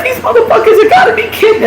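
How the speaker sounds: fast, high and laughing; laughing disbelief. Retold or imitated. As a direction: laugh it off in shock.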